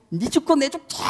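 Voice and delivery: put-on drunk father.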